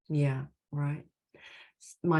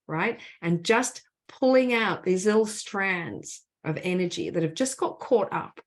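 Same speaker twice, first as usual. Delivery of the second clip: usual speaking voice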